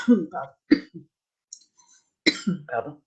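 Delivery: coughing, choking; coughing fit, excusing yourself; choking on a drink. Real, not acted. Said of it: "j'ai avalé de travers".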